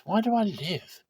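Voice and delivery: pitched well up, pained and pleading; anguished question. Acted. Pregnant wife crying out to God, pained high plea. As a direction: ask it as if the struggle inside hurts.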